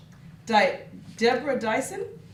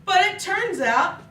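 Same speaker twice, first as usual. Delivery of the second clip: joyful realization; 6 semitones higher, 4 dB louder than usual. Acted. Fish's happy revelation.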